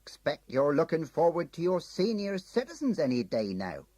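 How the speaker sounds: bright booking-office chat; cheery clerk. Acted. Ticket clerk Tom greeting a regular, cheery.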